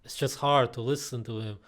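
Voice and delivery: ordinary talk, nothing marked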